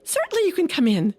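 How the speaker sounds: a tiny mouse gladly lets him in; little mouse. Acted. Mouse welcomes the frog in, quick and high. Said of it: "just a little mouse in there".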